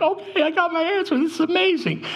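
mock tearful squeal, very high pitched; happy crying. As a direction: imitate yourself overjoyed and in tears, voice way up high. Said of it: "started crying 'cause I was happy".

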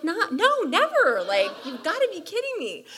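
high, loud, emphatic no; shouting a laughing never at the friend; emphatic refusal. Retold or imitated. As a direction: refuse with mock horror. Refusing to ever live there.

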